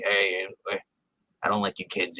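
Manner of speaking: low, soft growling grouch; grumpy snarl voice. Retold or imitated. Friend imitating Max's snarling voice at the kids. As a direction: growl it like a scowling old grump. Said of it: "I love that he does that voice".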